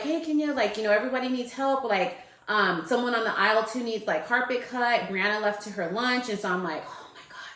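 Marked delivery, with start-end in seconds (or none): 0.0-5.0 lightly imitated store intercom call, pitched up and quick; higher-pitched mock store page; intercom announcer. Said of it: "on the intercoms at Home Depot". 6.9-7.5 very soft, voiceless retold groan of words; hushed, irritated aside; whispered exasperation. Irritated reaction to being paged.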